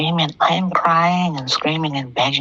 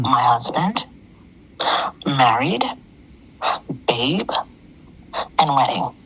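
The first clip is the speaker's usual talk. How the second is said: "My husband", "married", "babe" each set apart by a long pause, rising, then "wedding" falling; pointed listing; 2 semitones lower, slower than usual. Real, not acted. Caller listing the show's key words to Damien, each of "husband, married, babe, wedding" set apart. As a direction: lay out "My husband, married, babe, and wedding" one item at a time.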